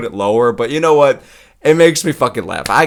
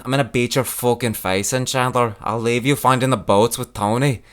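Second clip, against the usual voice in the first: Paddy Pimblett impression. Comic imitating fighter's rant on mental health, Scouse impression. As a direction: put on a Liverpool fighter calling everyone lad. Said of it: "up next to Paddy Pimblett".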